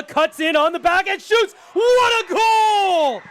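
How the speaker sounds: high stretched "What a goal"; long goal yell. Real, not acted. Goal call.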